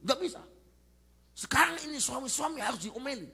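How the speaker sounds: high, loud insistent retort; mock indignant wife. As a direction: argue back as a nagging wife. Acts wife insisting husbands need scolding. (istri ngotot, tinggi dan keras)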